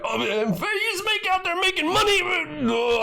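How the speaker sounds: high garbled imitation of an upset ranter; high-pitched spluttering mock rant; mock screaming. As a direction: parody his screaming, dissolving into blah. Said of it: "he's screaming and upset".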